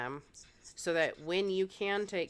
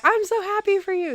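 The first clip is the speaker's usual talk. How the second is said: happy for you; 9 dB louder than usual. Retold or imitated. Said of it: "And he was like".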